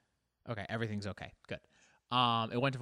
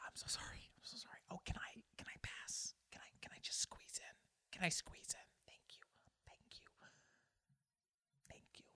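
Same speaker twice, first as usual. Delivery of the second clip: meek, voiceless whispered pleading; whispered politeness; 5 semitones higher, 16 dB quieter than usual. Acted. Driver asking to squeeze in, whispering like a mom. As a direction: whisper apologies and thanks like a timid mom. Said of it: "you, you whisper".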